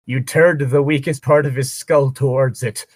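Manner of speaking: ordinary delivery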